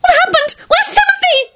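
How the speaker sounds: very high panicked cries; very high frightened screams; screamed in panic. Acted. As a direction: shriek it, fearing for Timothy. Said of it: "Solange jumped up and screamed".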